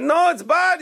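high-pitched comic British gentleman; British accent. Retold or imitated. Two English gentlemen talking in the bar, hammed-up British accent. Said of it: "He puts on this British accent".

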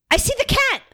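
high, loud sudden yell; real excited outburst; excited shout. Real, not acted. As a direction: blurt it out on spotting it. Spots the cat mid-conversation.

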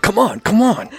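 high-pitched, strained urging; squeaky, pressed plea; urgent egging-on. Acted. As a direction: urge your sparring partner to play along. Kid straining to throw Chess.

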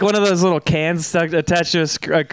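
ordinary delivery

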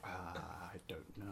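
sheepish whisper with a laugh. Real, not acted. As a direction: breathe it out, embarrassed.

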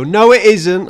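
indignant retort. Retold or imitated. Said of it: "and they go".

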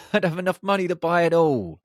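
giggling through the daydream, voice up high; laughing. Real, not acted. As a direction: laugh as you picture buying it all. Daydreaming about owning island resorts.

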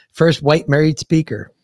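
ordinary talk, nothing marked

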